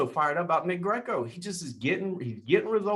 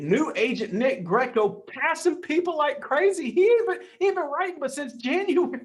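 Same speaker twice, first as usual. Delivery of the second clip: much higher, fast, gushing and giddy; can't contain the excitement, words tumbling out; excited; 10 semitones higher than usual. Real, not acted. Host gushing about a brand-new agent climbing the ranks, giddy and fast.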